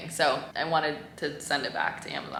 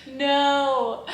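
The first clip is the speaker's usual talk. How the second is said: flat refusal; 8 semitones higher, 5 dB louder than usual. Retold or imitated. Retold reaction to eight blocks.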